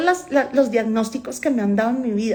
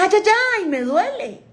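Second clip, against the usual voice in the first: pained yelp; 10 semitones higher than usual. Retold or imitated. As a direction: cry out as if grabbed too hard. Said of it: "yo pegaba el grito".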